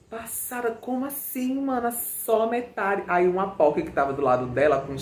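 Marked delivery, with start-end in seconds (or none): 0.1-3.0 higher, amazed, slowing on só metade. Retold or imitated. The girls answering Priscila, higher and amazed. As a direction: sound floored and starstruck.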